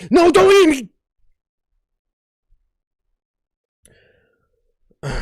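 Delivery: high, loud scream of pleading; shrieked last plea; terrified scream. Pretend. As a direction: scream for your life, hammed-up. Cheeseburger about to be eaten.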